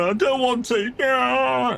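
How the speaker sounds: put-on high, whiny child's refusal; mock a sulking kid; lightly voiced whining child. Kid refusing in retold scene.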